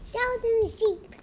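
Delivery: high put-on voice for the sheep; child presenting her toy sheep. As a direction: announce him in a silly sheep voice.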